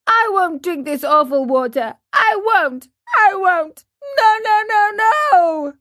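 put-on high, loud childish wailing; tearful puppet boy digging in; crying tantrum. A narrator's reading. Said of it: "bursting out crying".

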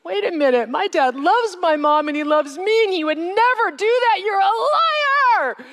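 put-on high child's voice building to a crying shout; disbelieving child. Young girl to the grieving woman, building to a tearful shout. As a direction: refuse it, rising to a tearful yell. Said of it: "I remember thinking".

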